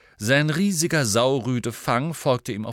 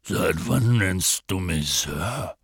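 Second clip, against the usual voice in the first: slow, low, puzzled character voice; baffled giant; 4 semitones lower, slower than usual. A narrator's reading. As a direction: draw it out in lumbering bewilderment. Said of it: "Hagrid blickte verdutzt".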